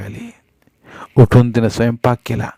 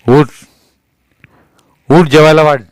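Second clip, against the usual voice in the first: loud barked command; angry order; 4 semitones higher, 8 dB louder than usual. A narrator's reading. Husband ordering his wife to serve food, loud. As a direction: order her to get up.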